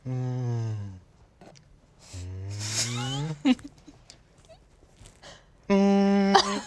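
mock hum of the score; hummed movie sound. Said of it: "quote the whole movie".

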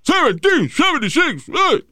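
high, slow mocking imitation of a ranting man; mock yelling bully. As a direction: ham up the angry guy shouting the year. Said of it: "el tipo empieza a gritarle vaina".

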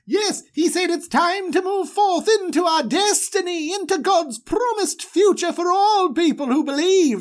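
high, lofty and proclaiming; Aaron, lifted high and solemn; grand Aaron voice. Acted. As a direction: announce it like a pompous herald. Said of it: "And Aaron's like".

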